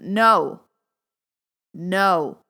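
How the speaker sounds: loud, decisive refusal; firm no. Shown as a demonstration. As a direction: say no like you mean it. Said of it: "the difference in the tone of my voice".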